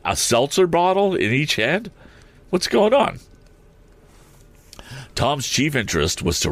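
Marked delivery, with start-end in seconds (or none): 0.0-1.9 incredulous, high rising question; baffled. Real, not acted. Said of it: "that's so weird". 2.5-3.2 very high-pitched bewilderment; baffled. Real, not acted. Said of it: "that's so weird".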